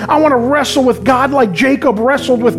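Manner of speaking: rally the congregation with rising conviction; impassioned altar-call preaching; fervent preaching. Real, not acted. Preaching climax over music.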